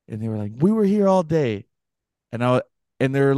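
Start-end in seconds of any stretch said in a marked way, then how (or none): 0.6-1.7 high retold indignant retort; arguing. Couple dispute the intruder's story, imitated arguing. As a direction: imitate a homeowner contradicting the intruder. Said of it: "kinda arguing with him".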